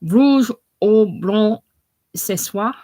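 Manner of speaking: slow, loud, high mock French accent; bad French accent. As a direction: put on a hammed-up French accent. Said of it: "in a bad French accent".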